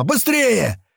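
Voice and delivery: high, hurrying yell; urgent shout. A narrator's reading. Gunner hurrying the rescuers, high shout. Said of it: "закричал Няма".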